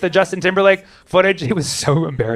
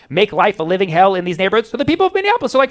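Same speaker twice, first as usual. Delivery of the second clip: ordinary delivery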